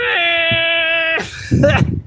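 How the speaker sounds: high-pitched real laugh. Real, not acted. Laughing at own silly voice, high laugh. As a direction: laugh high after the squeal.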